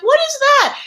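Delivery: sudden panicked question; alarmed what. Retold or imitated. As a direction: shoot up in shock. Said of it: "and I'm like".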